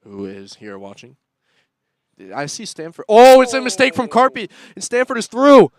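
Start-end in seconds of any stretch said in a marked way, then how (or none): 2.3-5.7 very loud, high cry as the striker breaks through; excited call. Real, not acted. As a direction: shout the breakaway at full pitch. Stanford breaks through on a mistake.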